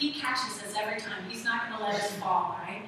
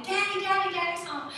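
high-pitched toddler glee, acted; excited little child; 8 semitones higher, 3 dB louder than usual. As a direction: squeal it like a child greeting Daddy. Voices toddler greeting her father.

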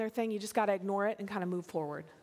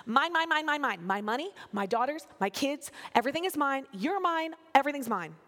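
mock man tantrum, grabby and insistent; hammed-up possessive outburst; Laban; 3 semitones higher than usual. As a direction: play a grown man throwing a toddler's fit. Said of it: "having a full-on man tantrum".

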